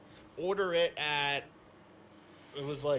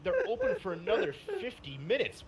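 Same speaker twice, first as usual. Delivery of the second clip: higher-pitched, disbelieving objection; retold incredulous protest; 6 semitones higher than usual. Customer retelling his argument with the driver, incredulous. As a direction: argue back in a raised pitch, retelling it. Arguing back at the delivery driver.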